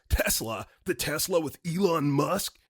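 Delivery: incredulous caller's mock yell, rising at the end; interrupting yell. Angry caller interrupting the operator about Tesla, mock yell. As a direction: imitate an irate caller cutting in with a question. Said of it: "he interrupts me and yells".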